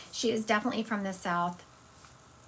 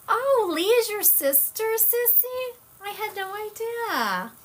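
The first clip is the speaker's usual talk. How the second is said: much higher, delighted surprise; surprised; 12 semitones higher, 3 dB louder, slower than usual. Real, not acted. Host finding out two guests are sisters, surprised. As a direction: exclaim it high, as news to you.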